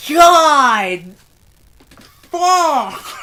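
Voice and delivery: loud, high cry of real distress; anguished outburst. Real, not acted. Reader floored by the chapter's ending, anguished shout. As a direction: shout it out, overcome by what was read. Overcome by chapter just read.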